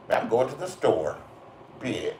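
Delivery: low, soft aside with "bitch" tacked on; imitated speech habit. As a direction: drop the final word softly, as a verbal habit. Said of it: "That's the way they all talk. They just end the sentence with".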